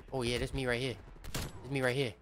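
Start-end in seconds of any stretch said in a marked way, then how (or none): none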